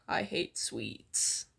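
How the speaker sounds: low, slow disgusted sneer; flat low scornful drawl. Acted. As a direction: drag it out with contempt. Katsuki sneering at redhead.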